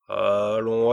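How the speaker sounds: loud, impatient urging. A narrator's reading. Impatient king pushing the dwarf for an idea. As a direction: hurry him, out of patience. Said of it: "dit le roi impatienté".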